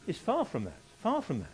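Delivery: usual speaking voice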